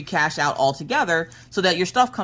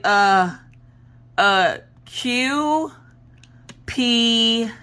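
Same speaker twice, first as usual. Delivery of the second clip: higher, hesitant, drawn-out letter guesses; unsure guessing; 6 semitones higher, much slower than usual. Retold or imitated. Son guessing letters at his eye exam, unsure and hesitant. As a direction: mimic a kid stalling over each letter.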